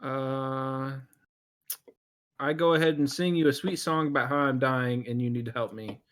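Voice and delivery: ordinary delivery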